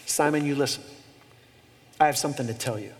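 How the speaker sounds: a hint of a stern teacher; stern warning. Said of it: "which is a very stern way of saying".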